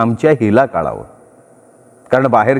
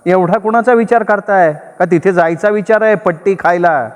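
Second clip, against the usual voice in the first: wife voiced high, pressing and suspicious; wife's voice rising; 6 semitones higher than usual. A narrator's reading. Wife rising to a suspicious pitch at her husband. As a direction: push the question higher and sharper. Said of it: "तिचा आवाज चढलाच".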